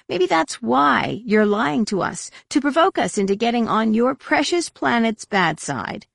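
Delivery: high, pointed accusation; accusing. A narrator's reading. Mara accusing Roel of provoking them, scornful accusation.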